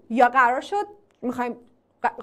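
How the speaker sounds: usual speaking voice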